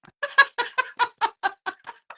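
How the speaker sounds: high, loud burst of laughter; hearty laugh. Real, not acted. Owning up to always laughing after blunt truths, hearty laugh. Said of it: "Aí eu dou risada".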